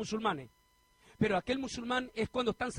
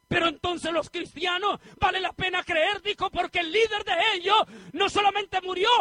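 loud, rising sermon climax; fiery preacher's shout; preaching at full force; 8 semitones higher, 7 dB louder than usual. Real, not acted. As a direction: proclaim it to the whole church.

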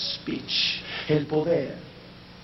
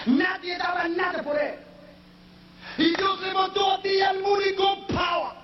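high chanted preacher's cry; sing-shout it to the rafters; preaching at full force; 14 semitones higher than usual. Acted. Preacher proclaiming God's power to the church, sung shout. Climax of sermon.

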